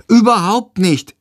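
put-on high, indignant child's retort; grumbling back. A narrator's reading. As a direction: protest in a high kid's voice. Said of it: "meckert Tom zurück". (meckernd, mit hoher Kinderstimme)

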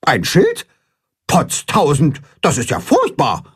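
leicht höher, aufgeregte Gans; aufgewühlt schnatternd. A narrator's reading. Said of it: "schnattert Grimm aufgewühlt". (Gans Grimm schnattert aufgewühlt)

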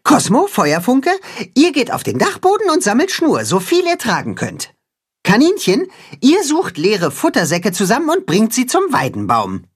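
high, earnest rallying voice; high earnest cat-hen rallying the farm animals. Acted. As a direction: unite them, high and determined. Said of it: "Dann legt Timme los".

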